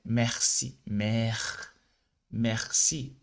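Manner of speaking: Merci with an open è and throat R; mer, merci, open vowel. Shown as a demonstration. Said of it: "ele tem um som aberto". (merci com e aberto)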